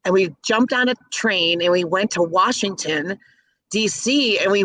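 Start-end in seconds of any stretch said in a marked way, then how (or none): none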